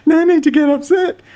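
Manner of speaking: high, falsetto mock of the lawyer. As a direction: squeak it out like a smug lawyer. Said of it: "He just goes".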